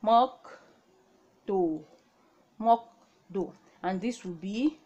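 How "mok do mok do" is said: mok do spoken clearly; Korean reading. Shown as a demonstration. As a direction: model mok do. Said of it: "this would be".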